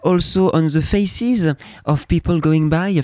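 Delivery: slow English lyrics in a heavy French accent; teasing French-accented recital. Shown as a demonstration. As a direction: drag out the song words in a broad French accent, th as z. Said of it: "avec mon bel accent".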